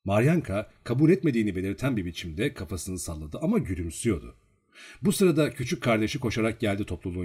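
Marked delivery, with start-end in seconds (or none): none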